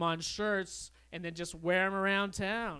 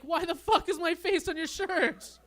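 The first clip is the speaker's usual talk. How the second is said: much higher, louder hammed-up outrage; mock outraged stranger; 11 semitones higher, 5 dB louder than usual. Stranger confronting the man in his shirt, hammed-up outrage. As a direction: squeal it as a mock offended guy.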